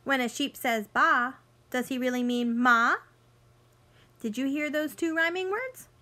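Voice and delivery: bright storybook read-aloud for little ones; picture book voice for kids; reading to children. Acted. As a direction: read it like story time on the rug.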